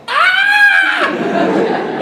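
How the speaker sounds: the penny finally drops; sudden realization. Retold or imitated. Seeing the episode on TV, yelping in realization. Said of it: "I was like".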